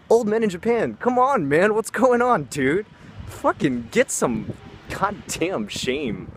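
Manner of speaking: high and loud, pleading in disgust; exasperated yelling. Real, not acted. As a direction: come on, have some shame. Berating old men in Japan.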